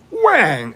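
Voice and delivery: sharp high "whang" for the slap; slap sound. Retold or imitated. Telling how grandma slapped the boy, high slap sound. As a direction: punch out the slap noise.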